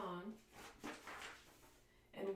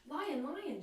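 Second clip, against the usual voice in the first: lightly raised, bright calling voice; little bird voice; 7 semitones higher, 4 dB louder than usual. Acted. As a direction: call out like a curious little bird. Bird calls to the lion.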